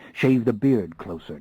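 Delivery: ordinary delivery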